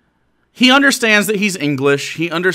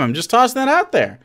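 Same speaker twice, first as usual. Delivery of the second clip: normal talking voice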